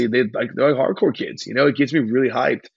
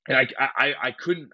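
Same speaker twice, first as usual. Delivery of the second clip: ordinary talk, nothing marked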